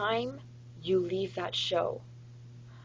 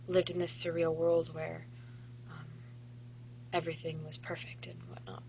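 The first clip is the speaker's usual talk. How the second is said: ordinary talk, nothing marked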